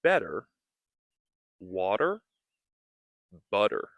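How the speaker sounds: each word spoken out, flap T; practice words. Shown as a demonstration. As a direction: tap the T in better water butter. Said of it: "we can practice".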